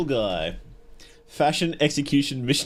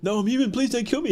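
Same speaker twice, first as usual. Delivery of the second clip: higher, mock-panicked begging; hammed-up plea for his life; mock pleading; 10 semitones higher, faster than usual. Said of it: "as his voice is drowned out".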